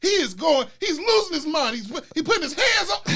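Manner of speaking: high-pitched, panicky tattling; hammed-up high hysterical report; mock frantic mom. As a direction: imitate a mother raising the alarm. Mother's frantic report voiced.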